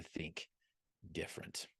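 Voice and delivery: hushed for effect; whispered punchline. Real, not acted. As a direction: drop to a dramatic whisper on different. Dramatic punchline on key word.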